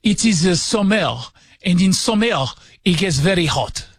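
put-on French accent. Shown as a demonstration. Radio host obliging a request to explain the heat in a French accent. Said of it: "do it in a French accent".

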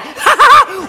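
high shouted laughter; shrieking laugh. Retold or imitated. Girlfriend laughing.

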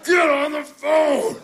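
very high, drawn-out shout; imitated dad hollering. Dad calling his wife to the phone, imitated holler. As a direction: holler through the house, slow and high. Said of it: "And then he goes".

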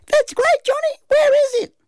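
very high-pitched mum; Mum's voice. A narrator's reading. Mum hearing her boy's good news, very high voice. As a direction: put on a very high motherly voice.

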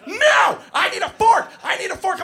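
put-on high-pitched toddler tantrum yell; screaming three-year-old. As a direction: scream a demand like a furious preschooler. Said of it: "I'm being screamed at point blank".

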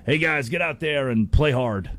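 ordinary delivery

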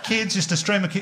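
ordinary delivery

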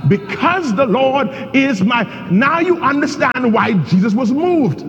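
a sermon at full force; fiery preaching. Acted. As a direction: drive it home to the church.

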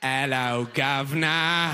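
slow, stretched mock-Cockney greeting; mock British accent. Delighted listener parroting a lost British person, mock British accent. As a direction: parrot British sounds, drawing them out. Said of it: "They're just making sounds you like".

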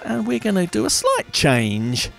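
squeaky voice. Real, not acted. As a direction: voice cracks up high. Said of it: "Squeaky voice there".

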